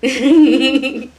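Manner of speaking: loud bright laugh after a howl; burst of laughter. Real, not acted.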